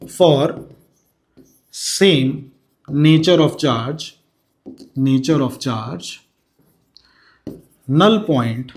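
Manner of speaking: slow, word by word, for students to write down; teacher dictating notes, pausing between words; slow dictation. Acted. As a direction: spell out the rule so the class can copy it.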